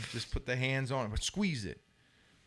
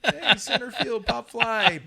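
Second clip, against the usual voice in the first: higher, louder shouted call across the field, laughing; hammed-up outfield shout; mock call out; 11 semitones higher, 10 dB louder, slower than usual. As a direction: yell the fly ball warning, half laughing. Calling out a fly ball.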